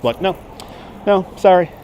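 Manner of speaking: ordinary delivery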